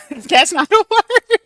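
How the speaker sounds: laughing through the words; high, loud laughing talk. Real, not acted. Laughing at her own grammar.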